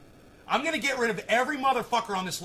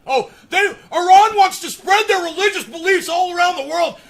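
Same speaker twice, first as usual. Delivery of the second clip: higher, louder sarcastic mimicry; mocking quote; 10 semitones higher, 12 dB louder than usual. Mocking the commenter's claim about Iran, loud sarcasm. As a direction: parrot his claim with contempt. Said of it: "Like he knows why Iran wants".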